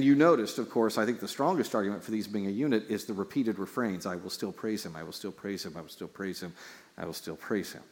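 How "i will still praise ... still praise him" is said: soft refrain. Shown as a demonstration. Quoting repeated refrain softly.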